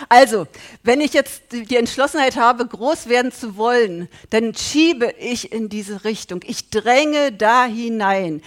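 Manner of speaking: loud, driving sermon voice; fervent preaching. Acted. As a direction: preach it hard to the congregation. Preaches with rising force.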